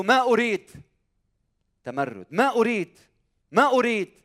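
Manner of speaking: loud, stubborn refusal; defiant refusing son. Acted. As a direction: throw back a rebellious no, loudly. Defiant first son in parable. (ابن متمرد يرفض بصوت عال)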